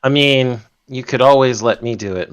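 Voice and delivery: ordinary talk, nothing marked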